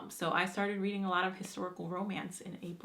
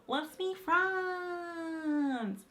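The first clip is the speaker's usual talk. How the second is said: drawn-out plea; 9 semitones higher, much slower than usual. Acted. Sign-off to viewers.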